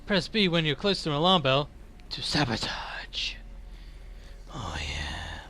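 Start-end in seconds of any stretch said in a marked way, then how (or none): 2.1-5.4 breathy conspiratorial whisper; a sly hushed oh yeah; sneaky whisper. Pretend. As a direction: whisper it like sneaking past guards.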